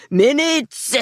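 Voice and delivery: high, urgent shout. A narrator's reading. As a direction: make him feel the time running out. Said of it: "Scotty shouted".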